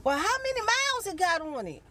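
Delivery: high mock yell across the lot; retold yell. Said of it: "So we yelling at each other".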